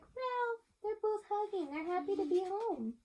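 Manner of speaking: put-on happy girl. A narrator's reading. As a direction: sound like a happy girl hugging her cat. Girl voiced happily.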